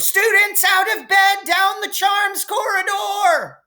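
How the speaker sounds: high, loud sing-song bellow of a gleeful poltergeist; Peeves bellowing. A narrator's reading. Poltergeist Peeves betrays the students to Filch, bellowing high and loud. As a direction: belt it out in a high, loud singing cry. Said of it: "Peeves bellowed".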